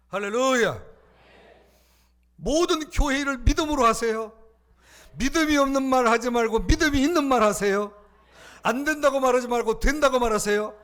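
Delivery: loud, high sermon climax; rally the congregation with every word; preaching at full force. Real, not acted. (온 힘을 다한 설교 외침)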